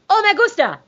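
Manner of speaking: high-pitched Spanish accent. Shown as a demonstration. Showing how you do a Spanish accent, high Spanish lilt. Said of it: "That's how you do a Spanish accent".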